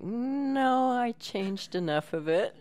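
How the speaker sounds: high, drawn-up mock reassurance; writer's dismissive reply. Guest imitating writer brushing off her worry, high offhand reply. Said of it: "he's like".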